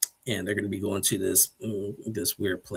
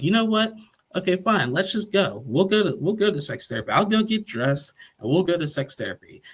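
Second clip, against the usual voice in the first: mock huffy snap; 9 semitones higher, 3 dB louder than usual. [name] snapping during fight.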